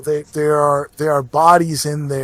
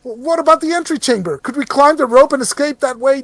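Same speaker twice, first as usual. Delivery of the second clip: high, anxious voice; desperate to find another way; worried pleading; 8 semitones higher than usual. Acted. Panicked adventurer pleading.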